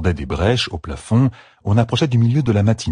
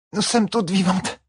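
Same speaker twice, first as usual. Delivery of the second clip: higher, parched-throat words; croaked; 13 semitones higher than usual. A narrator's reading. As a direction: croak it through dry lips. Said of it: "croassa-t-elle". (croassé, lèvres sèches)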